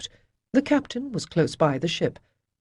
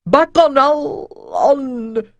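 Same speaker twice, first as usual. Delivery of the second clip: slow, higher, breaking off; faltering; 9 semitones higher, 8 dB louder, much slower than usual. Acted. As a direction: lose your nerve mid-word. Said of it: "Avon's voice faltered".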